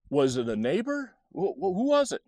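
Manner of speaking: high, stammering, agitated questioning; worked-up mom. Retold or imitated. Mom asking her child who the stranger was, worked up. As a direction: imitate an alarmed mother pressing for answers. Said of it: "Mom was definitely getting worked up".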